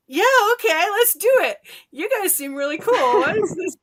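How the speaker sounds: put-on high, loud, thrilled child yes; excited kid self. Kid accepting the crocodiles' invitation, high and loud. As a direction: shout an eager yes like a delighted kid.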